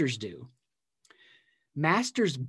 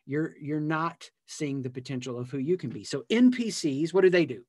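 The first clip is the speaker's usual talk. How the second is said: usual speaking voice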